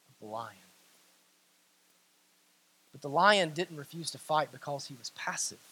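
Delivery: low, very soft sermon voice; hushed storytelling. Real, not acted. Preacher telling of the dagger plunged into the lion, hushed. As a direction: drop low and quiet for the solemn moment.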